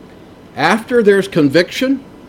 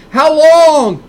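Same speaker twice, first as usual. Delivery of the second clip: high, loud plea of how long; anguished cry; 9 semitones higher, 5 dB louder than usual. Retold or imitated. Preacher voicing the prophet's plea, loud anguished cry. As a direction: cry out to God in dismay.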